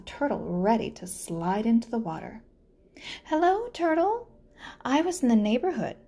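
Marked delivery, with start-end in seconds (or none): 3.2-5.9 overly sweet spider greeting. Acted. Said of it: "said Spider".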